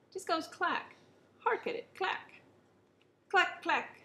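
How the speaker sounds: high, loud gleeful mocking. A narrator's reading. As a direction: relish every clack. King gleefully mocking clack.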